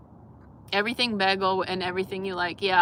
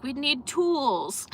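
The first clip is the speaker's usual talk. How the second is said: put-on kids' voice; 7 semitones higher, much slower than usual. Kids' answer voiced by teacher.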